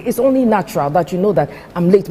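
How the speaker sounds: ordinary talk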